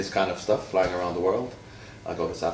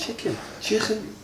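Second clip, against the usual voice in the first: chicken said the Scouse way, much higher and repeated; slower than usual. Shown as a demonstration. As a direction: sing out the Scouse word playfully. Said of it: "my favourite Scouse words are".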